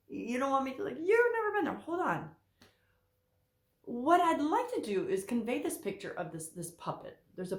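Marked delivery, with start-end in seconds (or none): 1.0-1.8 mock viewer protest.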